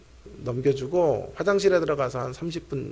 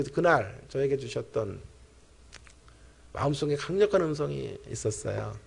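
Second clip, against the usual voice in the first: ordinary delivery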